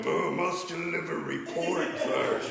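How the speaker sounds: slow, lumbering monster voice; Frankenstein. Acted. Monster answering his boss at the office, slow zombie voice. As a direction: drag the words out like a zombie. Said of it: "slow, limbering zombie".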